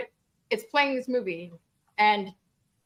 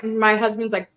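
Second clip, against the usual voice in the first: ordinary talk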